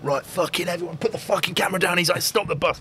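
lightly voiced tough recruit; commanding recruit. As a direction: voice the soldier ordering everyone. Recruit voiced taking over bus.

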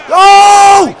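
high loud yell that breaks; voice cracking. Real, not acted. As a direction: shout so hard the voice gives out. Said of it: "that's embarrassing... I've lost my voice so much".

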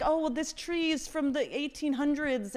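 high-pitched, put-on precious voice; hammed-up Hamptons boasting; mock rich homeowner. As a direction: show off an antique like a wealthy snob.

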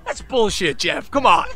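loud, high, incredulous protest; excited disbelief. Retold or imitated. Telling Jeff it can't be true, loud and incredulous. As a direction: scoff at the too-good news. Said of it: "I'm like".

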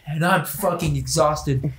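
ordinary talk

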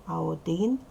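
ordinary talk

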